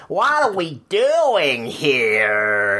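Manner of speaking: slow, drawn-out mock American tourist; horrified American tourist, hammed up slowly; American accent. Tourist in the UK, hammed up slow American accent. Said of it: "say it in an American accent".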